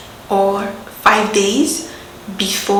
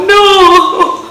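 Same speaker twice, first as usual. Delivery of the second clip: laughing no; 10 semitones higher, 8 dB louder than usual. Retold or imitated. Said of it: "That's how I would have gone".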